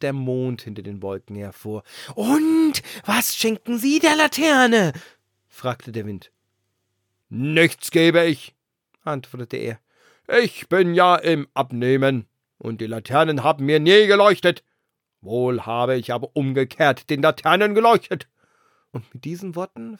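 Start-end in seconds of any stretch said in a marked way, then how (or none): 2.1-4.9 much higher, louder, slow call; booming wind question. A narrator's reading. The wind calling to the moon, booming. 7.3-18.3 much louder, distant moon voice; haughty moon. A narrator's reading. The moon refusing the wind, loud and lofty.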